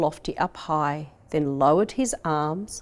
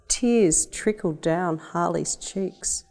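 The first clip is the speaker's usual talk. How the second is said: ordinary delivery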